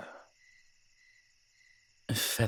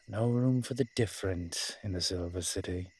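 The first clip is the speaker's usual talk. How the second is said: ordinary talk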